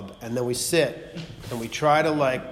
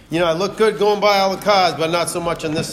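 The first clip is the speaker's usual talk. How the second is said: excited boast; 5 semitones higher, 8 dB louder than usual. Real, not acted. Boasting about his half-wrecked car.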